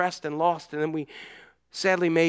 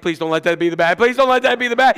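mock terrified pleading, rising; frightened plea; 4 semitones higher, 6 dB louder, faster than usual. Boy under the covers begging, mildly hammed-up fear. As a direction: beg under your breath in fear. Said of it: "I'm like".

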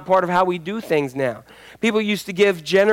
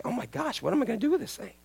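higher and much softer, imitating a fed-up owner; mock despair, lifted and hushed; exasperated; 5 semitones higher, 12 dB quieter, faster than usual. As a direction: owner at wit's end with the cursing parrot. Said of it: "She's like".

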